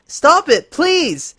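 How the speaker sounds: high, loud desperate begging; pained plea. Acted. Lyra begging Twilight to stop the spell, pained cry. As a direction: cry out through the pain. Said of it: "she said".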